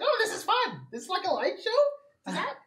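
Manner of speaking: high, delighted monster squeal; gleefully mock the party; gleeful creature voice. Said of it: "it's just gonna be like".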